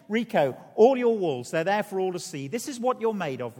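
loud, high address from the cross; crying out. Retold or imitated. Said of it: "and cried out".

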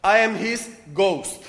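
slow, deliberate foreign English punchline; a visitor earnestly choosing each English word; mock careful non-native English, slowed down; careful best English. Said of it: "with his best English".

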